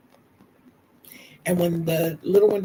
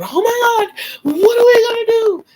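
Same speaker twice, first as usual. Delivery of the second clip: hammed-up freak-out at full volume; mock panic; 10 dB louder than usual. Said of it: "when people are panicking".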